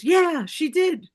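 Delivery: high, loud, drawn-out yes; emphatic confirmation. Retold or imitated. Sister confirming the visit, emphatic and high.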